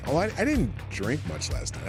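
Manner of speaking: ordinary talk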